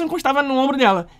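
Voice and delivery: normal talking voice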